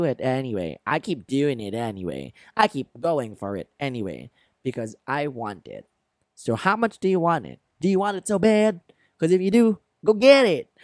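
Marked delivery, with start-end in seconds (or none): 6.3-10.7 rising higher and louder, pumping the listener up; hyped-up pep talk. Acted. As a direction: build to a loud, high rallying cry. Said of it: "Sorry for the accent".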